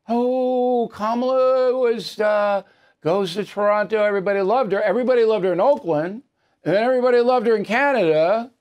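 mock gushing. Host parodies Frontline's praise of Kamala, mock gushing.

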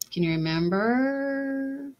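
slow, drawn-out coaxing question; slow teasing prompt to children. Shown as a demonstration. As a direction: stretch it out to coax the kids.

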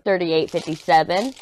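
ordinary talk, nothing marked

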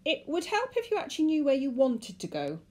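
high, loud, exasperated clerk; high-voiced, put-upon patience; strained politeness. A narrator's reading. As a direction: point it out to a difficult customer. Flustered clerk reasons with customer.

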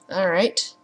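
ordinary delivery

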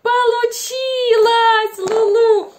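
high, loud, drawn-out cheer; excited cheer. Real, not acted. Cheering the last lock opened.

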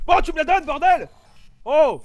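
exasperated demand. Real, not acted. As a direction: insist on it, fed up. Demanding the gun.

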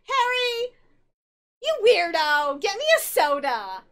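put-on loud, high bossy girl voice; bossy Monica. Monica ordering Harry around, loud bossy voice. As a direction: bark the order like a spoiled teen. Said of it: "Monica's like".

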